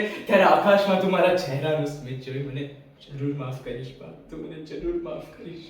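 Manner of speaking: low, hushed plea to parents; tender words fading away. Acted. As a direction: sink into very soft hope. Dead son consoles parents, seeking forgiveness.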